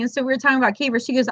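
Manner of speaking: usual speaking voice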